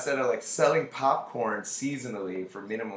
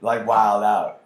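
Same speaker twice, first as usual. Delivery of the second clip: laughing; 4 semitones higher, 11 dB louder than usual. Retold or imitated. Quoting own thought, laughing.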